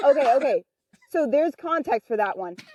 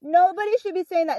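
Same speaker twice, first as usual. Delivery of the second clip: higher, preachy tattletale voice; mocking scolding girl; 8 semitones higher than usual. Mocking the annoying girl's preachy scolding. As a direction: mimic a self-righteous scold. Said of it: "and she was like".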